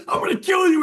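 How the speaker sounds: hammed-up threat shouted through laughter; mock furious yell. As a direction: yell it like a friend chasing you. Teammate yells chasing him.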